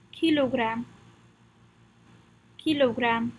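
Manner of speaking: kilogram as French "kee-lo-gram"; French pronunciation. Shown as a demonstration. Teacher leads repetition, kilogram in French pronunciation. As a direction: say kilogram with pure French vowels. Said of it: "Practice the pronunciation with me".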